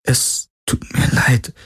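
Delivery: slow, halting, whispery apology; choked apology, voice nearly gone. Acted. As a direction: force it out through a tight throat. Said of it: "Ihre Kehle war wie zugedrückt".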